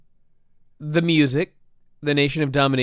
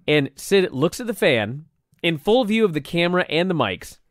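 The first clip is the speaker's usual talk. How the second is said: ordinary talk, nothing marked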